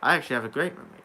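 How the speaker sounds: ordinary delivery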